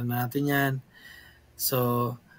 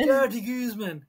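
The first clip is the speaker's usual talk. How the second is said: high, projected mock announcer saying "De Guzman" as "dee GOOZ-man"; announcer impression; 8 semitones higher, 3 dB louder than usual. Imitating an announcer introducing the comedian, in a high announcer voice. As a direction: announce it like an American show host. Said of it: "pagka ina-announce siya ng mga announcer".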